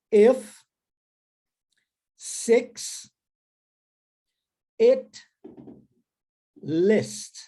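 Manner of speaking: slow, spaced "If, six, it, list" with e vowels; repeat after me. Shown as a demonstration. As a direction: model each word, pausing for students. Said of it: "repete comigo".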